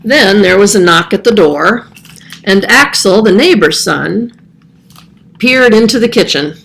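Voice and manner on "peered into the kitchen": ordinary delivery, nothing marked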